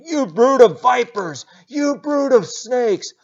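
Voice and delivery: put-on preacher leading the kids, megaphone yell. Shown as a demonstration. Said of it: "Kids, grab your megaphones again".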